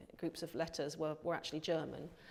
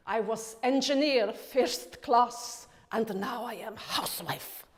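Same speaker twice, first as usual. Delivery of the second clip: slow, loud, hammed-up Russian accent; mother imitating a Russian diplomat's wife, broad Russian accent; deliberately bad Russian accent, much louder and slower; terrible Russian accent; 4 semitones higher, 9 dB louder, much slower than usual. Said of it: "a really terrible impersonation, uh, of a Russian accent".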